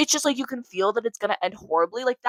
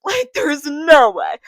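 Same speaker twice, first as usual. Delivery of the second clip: laughing loudly, slowed right down; 6 semitones higher, 11 dB louder, much slower than usual. Real, not acted. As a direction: laugh through the words. Laughing disbelief.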